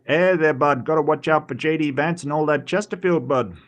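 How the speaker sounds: Canadian accent, played friendly and folksy. Teasing a Canadian friend about J D Vance, in a mock Canadian accent. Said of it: "That's my best Canadian accent".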